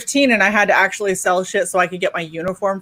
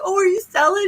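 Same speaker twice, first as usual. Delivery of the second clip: cooing; 9 semitones higher than usual. Retold or imitated. Neighbor cooing at child.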